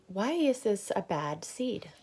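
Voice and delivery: usual speaking voice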